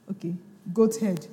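ordinary delivery

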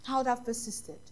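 softly repeated retold regret; subdued regret. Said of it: "he cried".

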